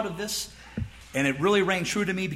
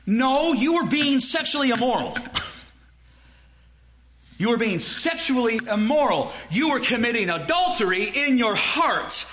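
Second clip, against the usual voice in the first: raised, forceful preacher's correction; emphatic rebuke; 5 semitones higher than usual. Acted. Preacher correcting a softened confession, emphatic rebuke. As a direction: pitch it up and hammer the charge.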